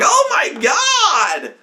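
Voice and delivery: very high, stretched-out cry of horror; shocked shout. Retold or imitated. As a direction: re-enact an appalled shout, drawn out. Repeats shocked reaction to shooting.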